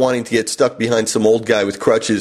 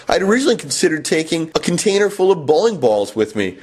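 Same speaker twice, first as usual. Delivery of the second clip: higher-pitched sarcastic play-dumb; mock realization; 4 semitones higher than usual. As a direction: feign clueless gratitude to have her on. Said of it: "I was having her on".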